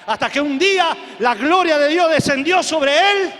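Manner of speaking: loud, high-pitched proclamation building on each phrase; shouted testimony climax; full-force preaching. Real, not acted. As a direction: proclaim a miracle to the whole congregation.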